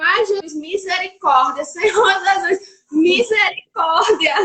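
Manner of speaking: loud, slow tearful prayer, voice breaking on Senhor Jesus; crying plea. Retold or imitated. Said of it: "eu falava".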